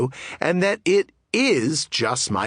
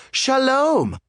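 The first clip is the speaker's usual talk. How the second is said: pitched up high, bright and cheerful; playful eager yes; 10 semitones higher than usual. Real, not acted. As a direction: toss it off like a delighted yes. Eager yes to every invitation.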